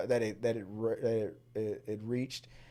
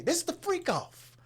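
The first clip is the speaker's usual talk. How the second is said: high-pitched retold exclamation; hammed-up high squawk; mock partygoer protest. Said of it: "That's what they would say".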